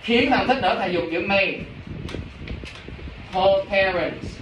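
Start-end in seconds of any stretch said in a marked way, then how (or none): 3.3-4.4 slow dictation. Shown as a demonstration. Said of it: "dictating English phrase slowly".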